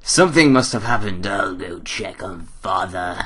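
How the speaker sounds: changed character voice. Acted. Player redoes the line about checking on Father, altered character voice. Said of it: "I just changed character completely".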